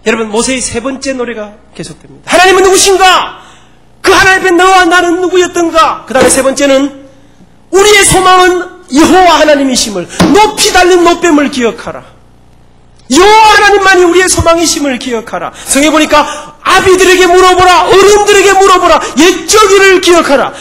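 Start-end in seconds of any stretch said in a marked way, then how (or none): 2.3-6.0 higher, louder ringing proclamation; full-force sermon proclamation; proclaimed. Acted. Sermon proclaims Moses' third song. 7.7-15.5 higher, louder ringing declaration; full-force sermon cry over music; preaching at full force. Acted. Sermon proclaims scripture over music. 16.6-20.5 higher, louder ringing command; full-force scripture proclamation; proclaimed scripture. Acted.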